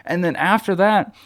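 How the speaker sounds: ordinary talk, nothing marked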